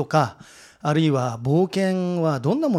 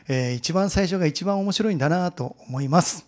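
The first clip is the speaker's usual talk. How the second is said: ordinary delivery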